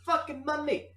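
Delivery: very high, loud whiny yell; shrill complaining voice. Acted. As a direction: whine like a wronged friend. Nick Dick complains, shrill.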